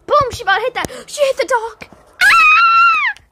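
building shout into a high scream; excited yell. Pretend. Reacting as a toy gets hit.

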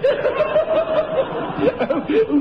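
a high, breathy laugh at her own cheek. Acted. Mother laughing at her own joke about the colonel's wife.

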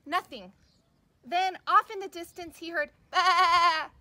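put-on lively storybook read for little kids, big character voices; reading to children. Teacher reading a picture book to kids, animated storytelling. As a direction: read it like circle time, animated and slow.

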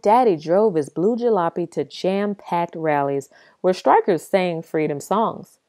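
ordinary delivery, nothing marked

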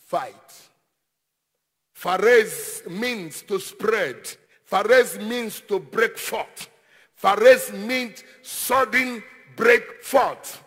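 normal talking voice

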